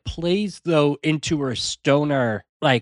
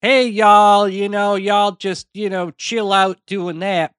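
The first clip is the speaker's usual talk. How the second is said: put-on a light laid-back Southern accent, higher; Southern stoner drawl; 5 semitones higher than usual. Imitating a Southern stoner telling someone to chill, light Southern accent. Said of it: "you could be like".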